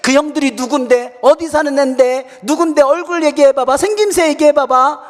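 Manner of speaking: loud, high motherly grilling; acted-out mother demanding details; prying interrogation. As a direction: fire off questions like a suspicious mom. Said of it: "어머니가 막 꼬치꼬치 물어요".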